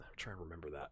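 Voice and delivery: breathy whisper to himself. Real, not acted. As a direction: whisper it while thinking. Muttering to himself while searching.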